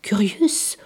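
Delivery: plain reading voice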